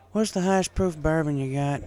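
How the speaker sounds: flat, blunt demand from a tired farm mare; voiced cowgirl, flat and blunt; flat country mare. A narrator's reading. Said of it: "she asked flatly".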